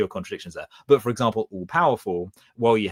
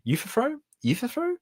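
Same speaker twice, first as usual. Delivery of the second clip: eufethro pitched up high as a question; unsure rising pronunciation. Shown as a demonstration. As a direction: ask eufethro in a high, doubtful squeak. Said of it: "I don't know".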